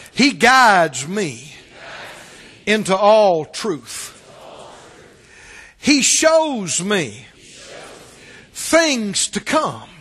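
very slow, punched words for the crowd to repeat; slow call-and-response, each word hit hard; leading a group recital. Shown as a demonstration. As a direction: spell it out line by line for the congregation. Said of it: "Everybody say this out loud".

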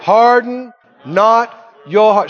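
slow, loud, every word weighted; slow emphatic recitation. Shown as a demonstration. Preacher leading the verse: slow, loud, drawn out. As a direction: lead the church through the verse slowly. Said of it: "Say it again".

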